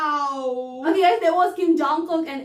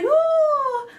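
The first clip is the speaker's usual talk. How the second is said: excited cheer; 10 semitones higher, 5 dB louder than usual. Real, not acted. Fan cheers as MV starts.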